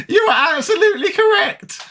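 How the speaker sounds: high-pitched laughing reply, voice cracking; giggling through the words. Real, not acted. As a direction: laugh it out while agreeing. Laughing while reading donor's tip.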